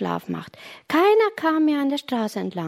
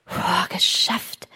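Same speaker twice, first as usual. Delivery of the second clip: relieved exhale; 4 semitones lower, slower than usual. A narrator's reading. Reaches the roof after climbing.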